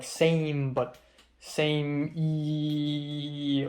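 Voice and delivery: "same E" with the E held very long; drawn-out E. Shown as a demonstration. Imitating texts Potter wouldn't send, drawn-out "E". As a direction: stretch the E after "same" on and on. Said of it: "lots of E's at the end".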